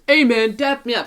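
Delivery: loud, high-pitched cocky greeting; imitating a random kid. Random kid approaching the host, loudly imitated. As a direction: put on a loud kid calling for attention. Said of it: "He looked at me, he goes".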